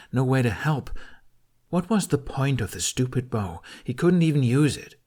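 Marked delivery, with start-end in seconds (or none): none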